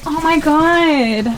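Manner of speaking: slow, high, loud oh my God then a laugh; amazed gasp. Real, not acted. Friend seeing the bar, stunned and laughing. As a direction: blown away by the house. Touring a luxury house.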